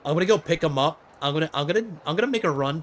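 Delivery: rushed, loud burst of excitement; can't contain the eagerness; too excited. Real, not acted. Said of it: "I'm getting too excited".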